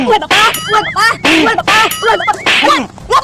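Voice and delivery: high, wailing cries of pain as if being slapped; comic pain wail. Acted. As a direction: yelp like a man getting beaten, hammed up. Getting slapped by bodyguards.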